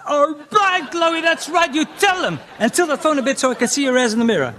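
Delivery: high, loud eager fanboy; mock eager fanboy. Comic mocking himself egging on a woman online, high and fast. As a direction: egg her on, rushing the end. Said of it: "And it's".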